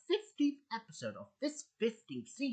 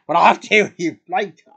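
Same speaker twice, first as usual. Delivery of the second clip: loud retort; 21 dB louder than usual. Acted. Answering dad's warning back, loud and defiant. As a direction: throw the warning straight back, loudly.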